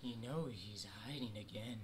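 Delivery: unvoiced, soft whisper. Real, not acted. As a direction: whisper it like a secret. Whispered spooky remark.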